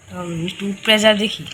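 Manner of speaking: normal talking voice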